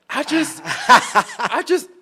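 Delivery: laughing through the words. Real, not acted. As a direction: breaks into a laugh. Bursts out laughing at slur.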